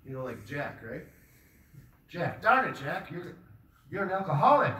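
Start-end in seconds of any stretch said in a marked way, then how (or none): 2.1-4.7 scolding. Acted. Scolding Jack for drinking.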